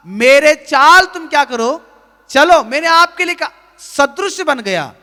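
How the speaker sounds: loud, rising preacher's call to confess; fervent sermon delivery; preaching at full force. Acted. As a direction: drive each line home to the congregation. Preaching Jesus as example.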